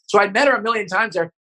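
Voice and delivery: ordinary delivery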